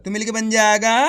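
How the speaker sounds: ordinary talk, nothing marked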